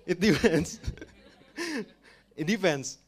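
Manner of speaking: high, loud, through laughter; real laugh breaking into the words. Real, not acted. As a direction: laugh while you repeat it. Laughing while repeating the answer.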